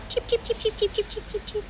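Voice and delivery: high, soft cheeping that fades away; tiny chicks chirping as they fly off; very soft, high peeping dying out; fading chick cheeps. Acted. Chicks cheep while leaving.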